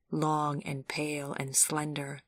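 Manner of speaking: usual speaking voice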